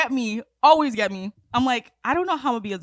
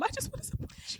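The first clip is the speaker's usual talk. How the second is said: much higher, breathy near-whisper; a mock tearful mother; half-sob, whispered promise; whimpering plea; 14 semitones higher, 13 dB quieter, faster than usual. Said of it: "pleading as a crying mom".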